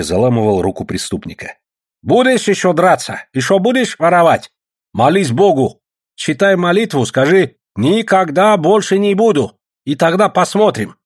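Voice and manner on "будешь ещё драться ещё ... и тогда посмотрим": loud, high scolding threat; bully him with raised voice; menacing interrogation. A narrator's reading. Sashka twisting the thief's arm, raised-voice threat.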